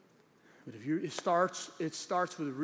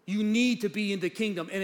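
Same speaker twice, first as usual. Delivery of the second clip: emphatic, raised preacher's voice; forceful preaching; 5 semitones higher, 6 dB louder than usual. Real, not acted. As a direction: drive each promise home to the congregation.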